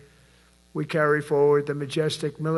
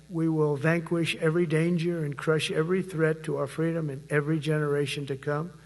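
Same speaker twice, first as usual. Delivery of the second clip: ordinary talk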